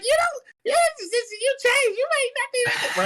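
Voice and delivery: mock the ex's squeaky complaint; whiny ex. Ex-girlfriend mid-argument, high whiny mocking voice.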